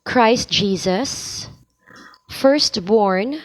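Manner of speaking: each word stressed and spaced by pauses; slow, weighted demo of key words. Shown as a demonstration. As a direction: lean on every title of Christ.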